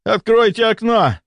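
high, desperate demand; crying out. A narrator's reading. Overheated patient demands air, crying out. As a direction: call out in feverish distress. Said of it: "кричал он".

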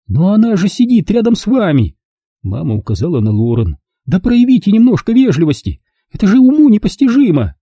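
high, outraged scolding. Acted. Mother scolds Kurt at table, high outrage. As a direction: scold him in disbelief.

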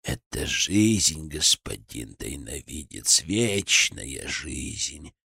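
put-on low, slow, hollow old man's voice; hollow cracked voice. A narrator's reading. Old villain Gradov speaks. (глухо и надтреснуто, низко)

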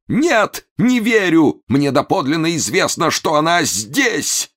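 very high, frantic denial; crying out. A narrator's reading. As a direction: cry out in desperate disbelief. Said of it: "вскричал". (отчаянно вскрикивает высоким голосом)

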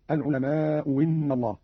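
"العلماء إن" said al-ulama-u winna; slow reading with a w starting "إن"; hamza turned waw. Shown as a demonstration. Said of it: "أو إبدالها واوا".

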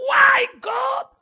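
very high, loud, slow crying shout to God; anguished scream. Acted. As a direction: drag each word out in a desperate scream at heaven. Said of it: "dia teriak".

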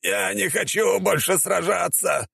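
high, drawn-out whine; hammed-up wailing warrior; howling. A narrator's reading. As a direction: howl it slowly in a squeezed-up voice. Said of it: "взвыл воин света".

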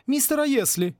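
high, probing woman's voice; pointedly suspicious query. A narrator's reading. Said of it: "подозрительно спросила мисс Сьюзен".

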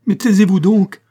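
high, loud, exasperated outburst; mounting anger. A narrator's reading. Servant silences her reproachful mistress, mounting anger. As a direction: shout her down, voice shooting up. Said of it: "avec l'accent d'une colère grandissante".

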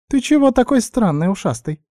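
high, squeaky suspicious question; suspicious guinea pig. A narrator's reading. Guinea pig sizing up the elf, squeaky and suspicious. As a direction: squeak it with wary distrust. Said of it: "подозрительно прищурилась свинка".

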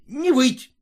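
резкий высокий окрик; гаркни на баб, чтобы замолчали. A narrator's reading. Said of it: "крикнул фельдшер". (резко прикрикнуть)

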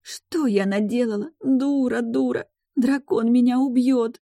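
put-on high, slow lamenting girl; high-pitched, self-blaming misery; tearful despair. A narrator's reading. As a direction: wail it slowly, as if doomed. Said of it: "сидело на полу и плакало".

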